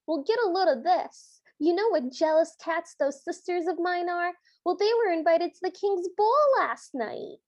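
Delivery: high, animated Brooklyn gossip voice; over-the-top Brooklyn accent. Retold or imitated. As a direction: imitate a cartoon Brooklyn gal dishing dirt. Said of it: "talking in a very over-the-top Brooklyn accent".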